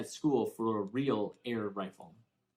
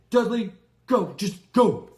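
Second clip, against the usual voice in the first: higher, much louder, breathless bark; wheezing order; 10 semitones higher, 12 dB louder than usual. A narrator's reading. Winded uncle ordering Harry away after the scuffle. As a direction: gasp out the order after the fight.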